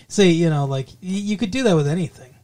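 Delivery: ordinary delivery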